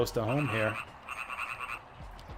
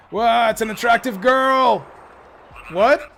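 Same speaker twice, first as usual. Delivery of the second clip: higher, louder stunned outburst; shocked shout; 10 semitones higher, 9 dB louder than usual. Acted. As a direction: blurt it out in disbelief. Voiced NPC shocked reaction.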